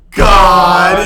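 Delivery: belting at full volume; shouted. Acted. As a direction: yell it like singing. Belting along in Rock Band.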